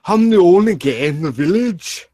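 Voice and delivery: put-on loud, high-pitched comic Welsh accent. Drunk streamer quoting to friends, put-on Welsh accent. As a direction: belt it out in a hammed-up Welsh lilt.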